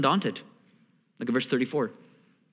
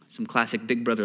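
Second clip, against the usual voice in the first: normal talking voice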